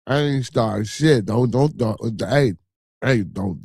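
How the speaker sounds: ordinary talk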